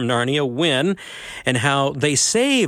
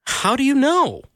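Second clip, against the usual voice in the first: put-on higher boy's voice; 11 semitones higher than usual. A narrator's reading. Shasta asks the voice.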